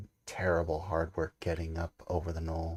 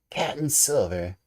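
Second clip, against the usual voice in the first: high, loud scornful surprise; incredulous cry; 7 semitones higher, 6 dB louder than usual. A narrator's reading. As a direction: throw the name back in disbelief. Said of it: "cried the captain".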